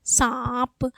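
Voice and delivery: सांप with long nasal आ, released प; nasalised vowel demo. Shown as a demonstration. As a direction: stretch सांप through mouth and nose. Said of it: "मुंह और नाक दोनों पे बल जा रहा है".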